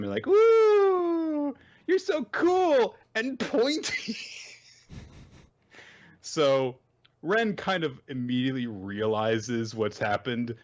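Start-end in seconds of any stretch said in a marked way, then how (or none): 0.3-4.2 very high squealed cheer; adoring fangirls. Retold or imitated. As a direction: gush in a put-on high voice. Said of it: "are acting adoring towards him".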